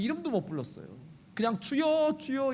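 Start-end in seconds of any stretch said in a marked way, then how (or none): 1.6-2.5 crying out. Retold or imitated. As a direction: call out to the Lord with awe. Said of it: "이렇게만 외쳤죠". (간절히 주님을 부르는 외침)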